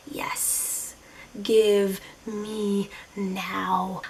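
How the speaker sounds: hushed, slow and breathy longing; greedy whisper. Retold or imitated. Craving pistachio at the counter, a slow greedy whisper. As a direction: whisper it like you can already taste it.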